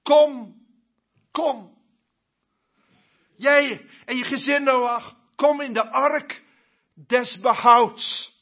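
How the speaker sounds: calling Noah. Acted. God calls Noah and his family into the ark, loudly. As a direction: call them into the ark. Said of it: "zei hij tegen Noach en zijn gezin".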